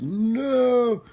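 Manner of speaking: high, drawn-out "no"; mock-horrified no. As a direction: wail a long, high no. Reacting to a viewer's snow comment.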